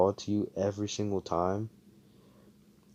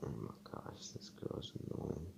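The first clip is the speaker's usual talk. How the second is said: breathy whisper, under the breath; whispered aside; 6 semitones lower, 18 dB quieter than usual. Retold or imitated. Telling a friend about an annoying girl, whispered aside. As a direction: whisper it so she can't hear. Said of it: "I was just like".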